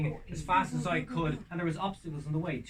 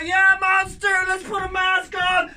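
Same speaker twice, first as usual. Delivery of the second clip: higher and loud, slow, hyped crowd cry; mock frenzied shout; 15 dB louder, slower than usual. Panicking crowd urging each other on, loud. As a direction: whip up panic.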